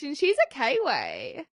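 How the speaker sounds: put-on slow, drawn-out New Zealand accent; Kiwi accent. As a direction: drawl it like Katie from Below Deck. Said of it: "Katie sounds like this".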